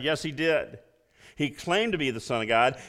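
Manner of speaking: usual speaking voice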